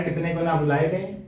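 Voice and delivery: ordinary talk, nothing marked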